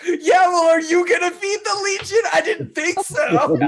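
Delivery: high, loud mock comeback through laughter; mocking retort. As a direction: tease with an exaggerated, high-pitched voice. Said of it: "Sean is like".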